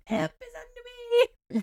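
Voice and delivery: high, hushed mock yell; retold yell. Friend retelling a yell down the hallway, softened mock yell. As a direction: imitate yelling the meme, but softly. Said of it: "I literally yelled".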